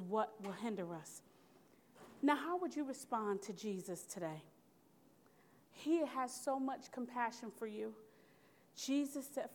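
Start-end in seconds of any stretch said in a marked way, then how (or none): none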